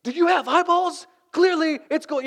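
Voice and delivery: high, loud exasperated outburst; heated argument. Retold or imitated. Staff arguing over the fan, shouting exasperated. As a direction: fire back in disbelief. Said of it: "it got serious, like".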